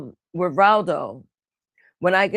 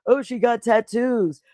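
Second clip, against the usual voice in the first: mock gossiping, pitched up; mocking others' hints; 5 semitones higher than usual. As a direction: mimic their gossip, stretching the words. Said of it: "go with these little hints".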